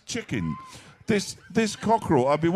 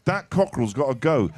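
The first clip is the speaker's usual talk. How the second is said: normal talking voice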